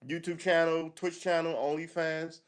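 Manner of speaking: high, showy self-promoting voice; high, hammed-up influencer voice; mock attention seeker. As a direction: parody someone begging for attention. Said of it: "You're just browbeat".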